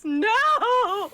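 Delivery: high laughing no; laughing shout. Real, not acted. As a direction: yelp it out laughing. Laughing refusal.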